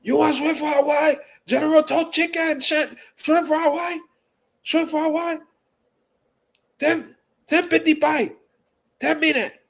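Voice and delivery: high yelling order-taker voice; impression of takeout lady. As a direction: rattle off the order like the phone girl. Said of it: "deepest voices".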